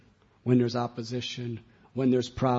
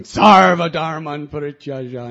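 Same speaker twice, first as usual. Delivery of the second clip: much louder confident call; strong proclamation; 3 semitones higher, 16 dB louder than usual. Acted. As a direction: boom it out with strength. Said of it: "then soon it's going".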